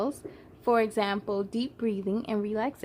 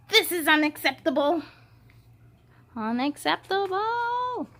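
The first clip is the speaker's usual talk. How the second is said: a hint of an offended villain; indignant Limbo; 5 semitones higher than usual. Limbo objecting to Alma, mild huffy outrage.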